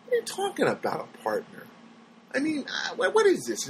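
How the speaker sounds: high, baffled disbelief; can't believe the question; incredulous. Retold or imitated. Incredulous reaction to survey.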